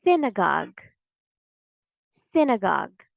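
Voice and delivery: synagogue as SIN-uh-gahg; correct pronunciation. Shown as a demonstration. Teacher gives the correct word, correct pronunciation. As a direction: stress "sin" at the start of synagogue. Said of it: "The correct pronunciation of this word is".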